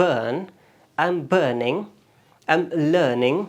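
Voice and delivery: BURN, BURNING, LEARNING with a long er, r left silent; British non-rhotic r. Shown as a demonstration. Teacher shows how British speakers say burn, r silent. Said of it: "British یہ آر کو پروناؤنس نہیں کرتے".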